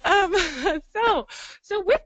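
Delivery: high, giggling okays as she recovers; flustered laughing. Real, not acted. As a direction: laugh it off and push on. Flustered after group joke.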